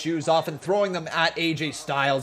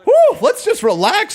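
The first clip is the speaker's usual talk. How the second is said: exaggerated sigh of relief; 9 dB louder than usual. Real, not acted. Exaggerated relaxation show.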